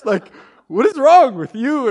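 loud, high, drawn-out accusation; exasperated blame. Retold or imitated. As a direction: demand it slowly in hammed-up exasperation. Indignant blame before turning it inward.

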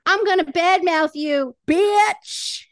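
loud, high-pitched trash talk; mock aggressive threat. Crude example before the polite version, mock-aggressive shout. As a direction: build to a hammed-up shout on the last word.

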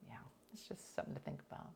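quick whispered aside; hushed quick aside. Real, not acted. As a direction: whisper it fast to yourself. Aside under the breath.

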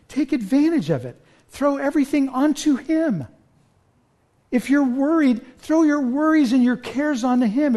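lifted, pleading preacher's call, slowing at the end; impassioned appeal. Acted. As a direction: urge them to cast their cares on him. Pleading exhortation to struggling believers.

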